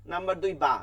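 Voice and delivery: ordinary delivery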